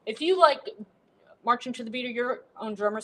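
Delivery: ordinary talk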